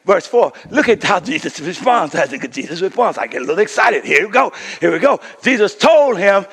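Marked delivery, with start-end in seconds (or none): none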